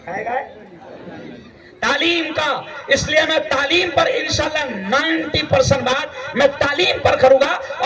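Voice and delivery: ordinary talk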